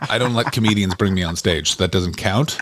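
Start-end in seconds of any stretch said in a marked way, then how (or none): none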